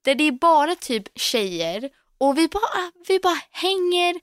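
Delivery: a touch of giddy gushing. Real, not acted. Gushing about salon.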